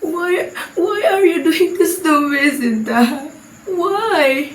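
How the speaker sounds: high, pleading cry of hurt; a hammed-up wounded plea. The mother cries out at her daughter, high and hurt. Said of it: "She cried loudly and said".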